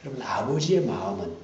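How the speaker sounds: usual speaking voice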